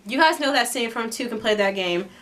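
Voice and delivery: ordinary talk, nothing marked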